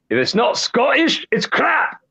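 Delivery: high, exaggerated Scottish accent. Host asked for a birthday treat, so he does a hammed-up Scottish accent. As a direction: ham up a Scottish catchphrase. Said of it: "This came from Mike Myers in Saturday Night Live".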